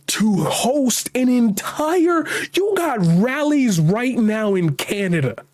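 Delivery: indignant rant climbing high, drawn out; mad. Real, not acted. Host berating anti-mandate protesters, mad and incredulous. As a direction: build from slow accusation to high-pitched outrage. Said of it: "Now I'm just mad".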